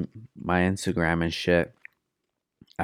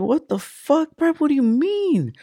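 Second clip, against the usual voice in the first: high-pitched mock astonishment; acting out bewilderment in a high voice; retold disbelief. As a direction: squeak out a baffled reaction. Retelling his startled reaction to Prep.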